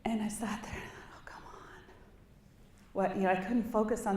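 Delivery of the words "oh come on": breathy under-the-breath whisper of frustration; exasperated whisper. Retold or imitated. Sitting in the meeting, fed up with the Senate, whispering to herself. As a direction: mutter it to yourself so no one hears.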